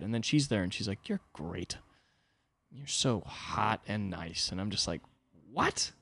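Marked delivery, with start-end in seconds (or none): 1.1-4.5 softer, slow and tender; sweet girlfriend. Retold or imitated. Girlfriend voiced softly. 5.5-5.9 a much higher incredulous what; stunned by the compliment; baffled. Retold or imitated.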